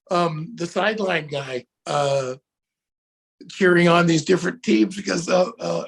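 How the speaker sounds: usual speaking voice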